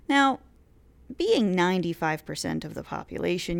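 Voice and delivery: ordinary talk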